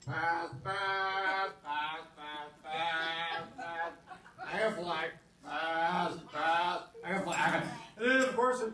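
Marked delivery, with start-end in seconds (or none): 0.0-3.9 low, softer, sloppy buzzing; drunk barfly. Pretend. Comic imitates a barfly, lightly drunk buzzing. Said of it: "My impersonation of a barfly". 4.5-7.6 put-on drunk barfly. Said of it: "My impersonation of a barfly".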